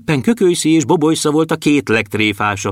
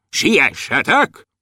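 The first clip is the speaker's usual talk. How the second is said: drawn-out, higher hurry-up call; guard calling; 4 semitones higher, much slower than usual. A narrator's reading. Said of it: "A strázsa is kiabálta". (elnyújtva kiáltó strázsa)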